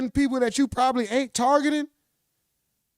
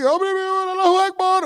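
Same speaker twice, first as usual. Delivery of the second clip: higher, raised mock chant; imitating the fighter's cry; mock yell; 7 semitones higher, 4 dB louder than usual. Streamer mimicking the press conference outburst, mock yell. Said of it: "he yelling and said".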